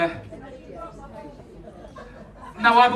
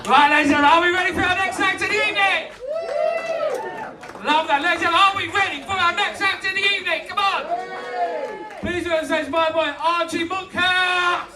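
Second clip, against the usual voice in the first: MC introduction; 7 semitones higher than usual. Real, not acted. Host brings on the next comic, hyped announcer call. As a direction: whip up the crowd for the next act.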